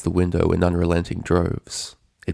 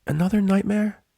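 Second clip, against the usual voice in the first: put-on much higher childlike concern; concerned Spike; 13 semitones higher than usual. A narrator's reading. Baby dragon asking Twilight about her nightmare. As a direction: ask gently, worried for her.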